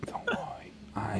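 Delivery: hushed unvoiced whisper. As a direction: whisper it like a secret agent. Whispered hero role.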